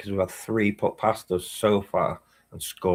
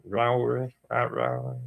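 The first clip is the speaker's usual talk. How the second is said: ordinary delivery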